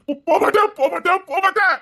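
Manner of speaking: high and pleading, words tumbling; panicked pleading. Acted. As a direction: beg her to stop, frightened. Husband panics, pleads to calm wife. (பயத்துடன் கெஞ்சுதல்)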